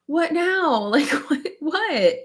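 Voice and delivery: puzzled husband. Retold or imitated. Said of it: "he was like".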